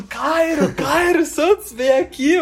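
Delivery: high-pitched mock call to a player; re-enacted fan hollering for a player's attention; imitated calling out. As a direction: call someone over from the stands, pitched well up. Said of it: "tava chamando".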